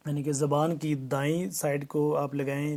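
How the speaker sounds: usual speaking voice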